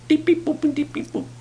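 high, little electronic beeps; high-pitched "beep, boop" keypad imitation; imitated phone beeps. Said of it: "That's me putting it in the phone".

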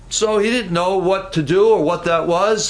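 ordinary talk, nothing marked

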